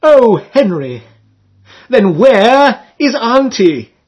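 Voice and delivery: put-on high, slow, wailing girl's voice; wild young maiden. A narrator's reading. Maiden to Henry on the towpath, high wild wail. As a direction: cry out in dismay like a startled maiden. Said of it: "she clasped her hands and said wildly".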